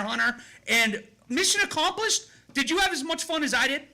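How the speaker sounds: radio host intro. Acted. Host reads guest introduction.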